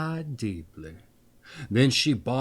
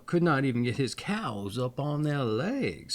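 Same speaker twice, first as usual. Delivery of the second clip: plain reading voice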